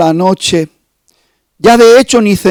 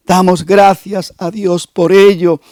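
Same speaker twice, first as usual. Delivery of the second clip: ordinary talk, nothing marked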